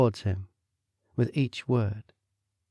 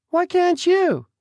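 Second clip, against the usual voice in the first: high, sneering throw-back of the question; mocking echo; 6 dB louder, slower than usual. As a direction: parrot it back in a much higher mocking voice.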